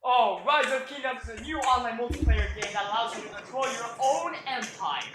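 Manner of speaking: high, eager sales pitch. Acted. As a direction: sell the game like an ad. Mock ad pitch in a skit.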